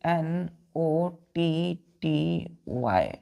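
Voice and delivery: letters spelled out very slowly, one by one; slow teacher spelling for children. Shown as a demonstration. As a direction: spell each letter out, drawn out. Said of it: "एक है बच्चों के".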